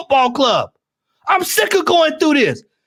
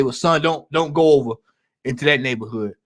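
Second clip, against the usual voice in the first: low, hushed fatherly warning; parent's warning; 13 semitones lower, 3 dB quieter, faster than usual. Retold or imitated. Parent warning his kid away, low and hushed. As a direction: lower your voice like a dad warning his son. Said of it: "your parents would tell you".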